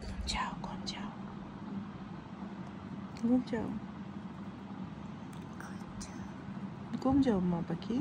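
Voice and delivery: soft hushed lull, nearly whispered; lulling to sleep. Real, not acted. Mother lulling her baby to sleep, hushed and soft. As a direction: hush the little one off to sleep.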